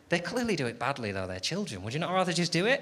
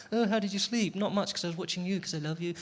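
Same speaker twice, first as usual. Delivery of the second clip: higher, cooing lovers; mock sweet cooing; new lovers cooing; 5 semitones higher, 4 dB quieter than usual. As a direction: gush like besotted new couple. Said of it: "you're like".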